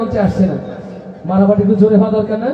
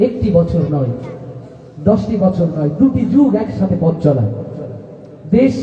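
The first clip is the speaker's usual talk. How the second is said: lower, measured recitation; poetic tribute; 4 semitones lower than usual. Acted. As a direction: introduce a beloved friend lyrically.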